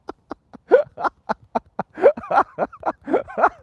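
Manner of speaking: long, loud, very high laugh; real helpless laughter. Real, not acted. Streamer cracks up at a terrible joke, helpless laughter.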